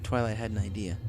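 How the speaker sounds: ordinary delivery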